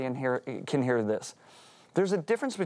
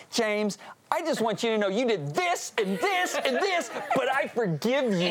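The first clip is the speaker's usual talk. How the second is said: much higher, slow and laughing, smugly listing faults; mock self-righteous forgiveness; 9 semitones higher than usual. Mocking how Christians confront James, high, slow and laughing. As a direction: ham up a preachy, fake forgiveness. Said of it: "this is the way we take that scripture".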